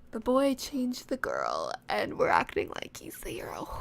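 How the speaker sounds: soft cringing whisper. Real, not acted. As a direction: whisper it in embarrassed disbelief. Mocking her own story.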